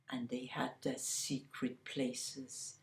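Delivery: hushed, secretive whisper. A narrator's reading. Storyteller whispers about the lovers' hiding spots.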